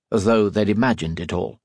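plain reading voice

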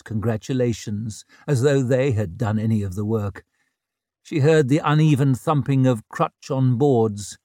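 ordinary delivery

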